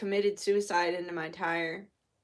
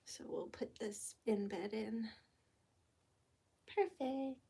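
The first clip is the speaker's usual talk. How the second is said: soft unvoiced whisper; 11 dB quieter than usual. Real, not acted. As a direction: murmur it under your breath. Whispered while placing candle.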